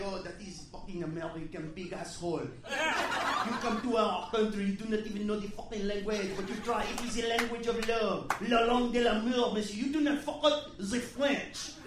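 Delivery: lightly put-on French waiter, indignant; a waiter lecturing Americans on his language; mock French accent. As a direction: scold in a thick French accent. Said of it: "he's like".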